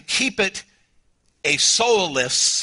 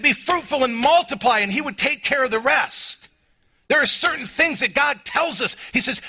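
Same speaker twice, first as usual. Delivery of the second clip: impassioned preacher; preaching at full force; 7 semitones higher than usual. Acted. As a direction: pour it out like a revival pulpit.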